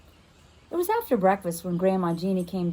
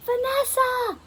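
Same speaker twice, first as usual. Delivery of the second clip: high call after her; slower than usual. Acted. Girl calls after her friend running off, high call. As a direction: call her name across the yard. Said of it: "I called".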